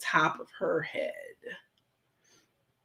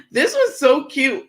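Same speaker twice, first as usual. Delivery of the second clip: laughing; 8 semitones higher, 6 dB louder than usual. Real, not acted. Laughing about her fridge.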